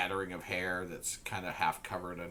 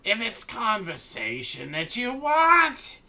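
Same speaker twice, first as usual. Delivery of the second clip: sly hag invitation; 9 semitones higher, 12 dB louder than usual. Acted. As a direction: lure them in sweetly.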